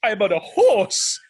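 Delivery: loud, high-pitched indignant rant; mock outrage. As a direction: rant at a kid in loud disbelief. Retold outraged rant at kid.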